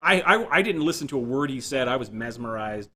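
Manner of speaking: usual speaking voice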